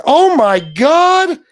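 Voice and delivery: drawn-out loud disbelief; shocked. Real, not acted. Reacts to a shocking sight.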